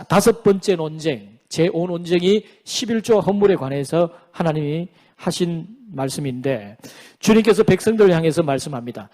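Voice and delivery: ordinary talk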